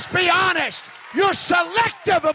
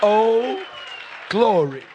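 mock mild glory; much slower than usual. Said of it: "the Pentecostal thing that some of you do".

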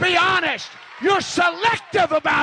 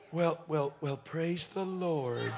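very low, much softer, slow deadpan praise; mock lukewarm Pentecostal; 7 semitones lower, 13 dB quieter, slower than usual. Preacher mocking a congregant's flat reaction, low deadpan mumble. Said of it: "the Pentecostal thing that some of you do".

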